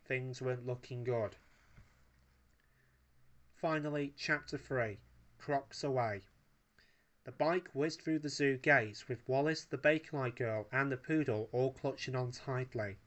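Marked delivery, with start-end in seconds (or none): none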